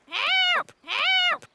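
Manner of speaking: very high parrot squawk; high talking-bird cries; parrot mimicry. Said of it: "I can teach Polly what to say".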